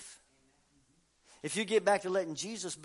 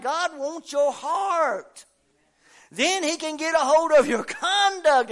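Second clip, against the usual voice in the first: loud, higher fiery preaching; preaching at full force; 9 semitones higher, 12 dB louder than usual. Acted. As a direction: drive every line home to the congregation.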